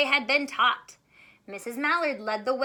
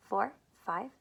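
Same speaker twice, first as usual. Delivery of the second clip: plain reading voice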